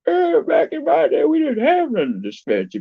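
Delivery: put-on high, slow, loud old man; crotchety grandpa rant. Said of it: "my old man voice".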